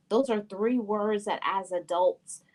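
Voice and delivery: ordinary talk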